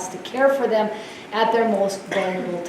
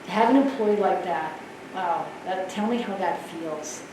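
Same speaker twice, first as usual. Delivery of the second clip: soft, lower, sympathetic HR voice; 4 semitones lower, 8 dB quieter than usual. Shown as a demonstration. HR leader showing how she soothes an upset employee, softly. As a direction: soothe them softly, like a caring agent. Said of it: "in my H R voice and say".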